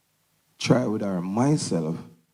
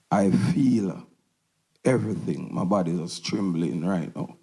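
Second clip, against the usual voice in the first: ordinary delivery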